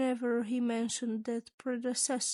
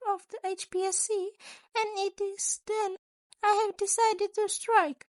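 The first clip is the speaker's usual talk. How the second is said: high, bright-eyed principal voice, raised; a sly, chipper school principal asking; Nezu's voice; 8 semitones higher than usual. A narrator's reading.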